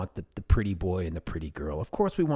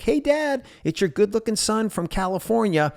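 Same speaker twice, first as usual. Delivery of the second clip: high, loud and bright hello to dad; cheery greeting; 12 semitones higher, 5 dB louder than usual. Retold or imitated. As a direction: announce yourself brightly to a forgetful father. Greeting his forgetful dad.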